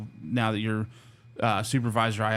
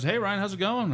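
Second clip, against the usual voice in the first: higher, cheery phone greeting from the boss; imitating the boss; 11 semitones higher than usual. Owner calling an officer on his birthday, cheery higher greeting. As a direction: lightly play the friendly owner calling. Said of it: "He goes".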